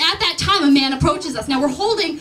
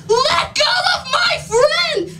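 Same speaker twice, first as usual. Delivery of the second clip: much higher, slow desperate yell; frantic shout; 12 semitones higher, slower than usual. Retold or imitated. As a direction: scream at him to let go. Shouting at the man.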